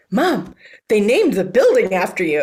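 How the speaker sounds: put-on young daughter's amazement. Daughter to mother outside the college, amazed child voice. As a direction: call out to Mom like an astonished little girl.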